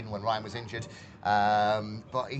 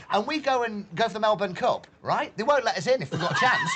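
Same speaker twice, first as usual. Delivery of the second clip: higher-pitched mock-outraged rant; comic indignation; 7 semitones higher, 4 dB louder than usual. Real, not acted. Pundit complaining about Australian racing rules, comic indignation. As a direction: protest the injustice, playing for laughs.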